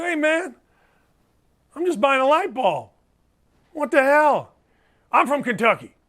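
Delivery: high-pitched, put-out reenactment; retold indignant protest. Host retelling a hardware store run-in, mock indignant. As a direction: act out an affronted exchange.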